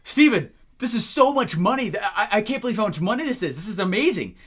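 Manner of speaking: loud, high thrilled gush; excited client. Retold or imitated. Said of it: "everyone's like".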